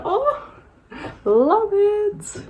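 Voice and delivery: high delighted squeal. Real, not acted. Reacting to palette packaging.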